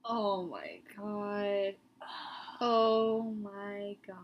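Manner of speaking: ordinary talk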